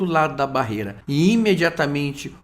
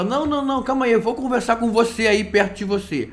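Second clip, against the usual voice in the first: stubborn protest; 6 semitones higher than usual. Acted. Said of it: "Você que é teimoso".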